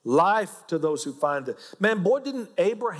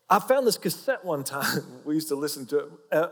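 ordinary delivery

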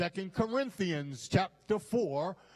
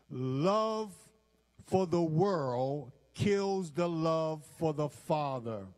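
normal talking voice

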